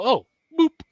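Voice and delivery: high, loud playful boop. Retold or imitated. Retelling how she tagged her opponent, a high playful boop. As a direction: tap him lightly and say it cutely. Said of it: "and I go".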